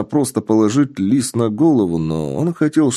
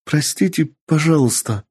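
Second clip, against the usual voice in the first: near tears; 7 semitones higher than usual. Acted. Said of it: "едва не со слезами на глазах".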